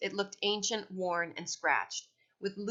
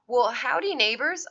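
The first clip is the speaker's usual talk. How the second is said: put-on big Southern-accented howdy, pitched up; 6 semitones higher, 8 dB louder than usual. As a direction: greet them in your best put-on Southern accent. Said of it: "in my best Southern accent".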